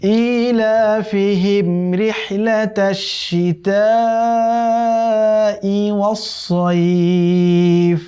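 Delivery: slow, drawn-out melodic tilawat; Quran recitation. Shown as a demonstration. Teacher recites the verse for students in slow melodic chant. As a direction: chant the verse slowly with long held vowels. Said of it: "দুই নম্বর আয়াতের মধ্যে আছে".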